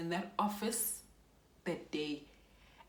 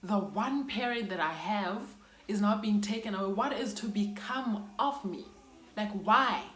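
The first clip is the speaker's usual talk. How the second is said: higher and louder, crying out why; a wail of grief, louder on each why; anguished cry; 4 semitones higher, 5 dB louder than usual. Retold or imitated. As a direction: plead into the air, heartbroken. Wailing after hearing of death.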